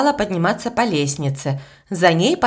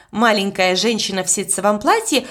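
ordinary delivery

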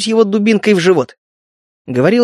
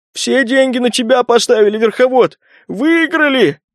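higher, gurgling excited voice; excited burbling; 4 semitones higher than usual. A narrator's reading. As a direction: burble it out in delight. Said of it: "возбуждённо забулькал".

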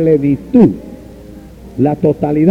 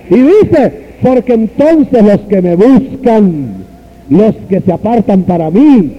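preaching at full force; 6 semitones higher, slower than usual. Real, not acted. Preacher to congregation quoting Malaquías, high fervent preaching. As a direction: lift the congregation with each cry.

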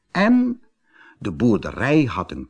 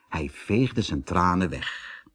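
plain reading voice

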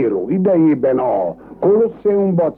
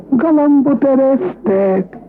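higher and slower than the first call; higher, drawn-out announcement; 11 semitones higher, slower than usual. Acted. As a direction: sing it out like a call. Groom announces again, higher. (magasabb, elnyújtott bejelentés)

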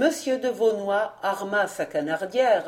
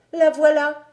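put-on high, loud little-boy voice; child's voice; 8 semitones higher, 8 dB louder than usual. A narrator's reading. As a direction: point it out in a raised child's pitch. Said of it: "L'enfant tourna la tête".

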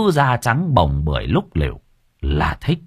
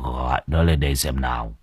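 put-on gruff old master; 9 semitones lower, 6 dB quieter than usual.

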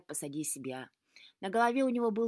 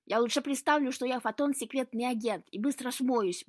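loud, higher-pitched grouchy reply; grumbled comeback; 5 semitones higher, 6 dB louder than usual. A narrator's reading. Cat refusing Luka's advice, grumbling loudly. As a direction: grouse it back with force. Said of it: "буркнул кот".

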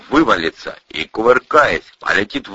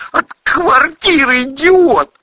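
high, loud, drawn-out accusation; agitated high-pitched yell at a fool; angry outburst; 6 dB louder, slower than usual. A narrator's reading. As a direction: blurt it out at the top of the voice. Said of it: "выпалил армеец".

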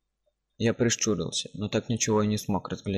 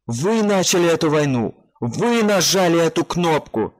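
booming, accusing man's voice, loud and high; strong male voice; 8 semitones higher, 8 dB louder, slower than usual. Acted. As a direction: thunder the accusation. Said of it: "прозвучал сильный мужской голос".

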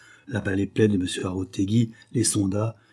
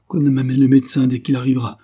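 louder commanding order; angry order; 4 semitones higher, 7 dB louder than usual. Acted. Duke to his valet, demanding the doctor, loud angry order. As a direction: snap the order at the servant.

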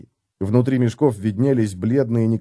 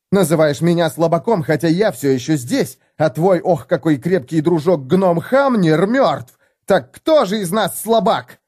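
higher, sarcastic taunt; biting mockery; 6 semitones higher than usual. A narrator's reading. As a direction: twist the knife with sarcasm. Felix mocking dead friend jibe. (Феликс язвительно высмеивает Готрека)

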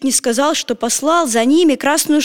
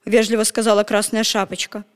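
ordinary delivery

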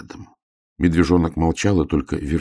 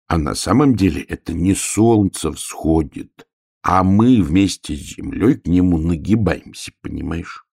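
plain reading voice

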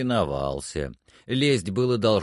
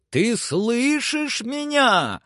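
high, drawn-out call; shouted question; 13 semitones higher, much slower than usual. A narrator's reading. Legrand checks the climber hears him, high drawn-out shout. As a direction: project it slowly to someone far above. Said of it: "закричал он".

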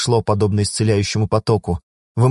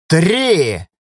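loud, high countdown yell; shouted countdown; 8 semitones higher, 3 dB louder than usual. A narrator's reading. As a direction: count down like a threat. Countdown continues.

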